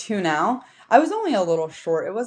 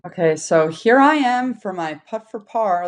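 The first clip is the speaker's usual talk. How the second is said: usual speaking voice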